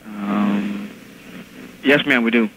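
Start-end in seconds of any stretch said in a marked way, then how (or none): none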